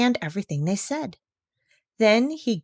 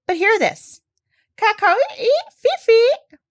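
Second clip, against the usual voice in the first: high, loud chirping bird words; bird talk; 7 dB louder, slower than usual. Acted. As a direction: squawk it out like a parrot. Parrot bird language.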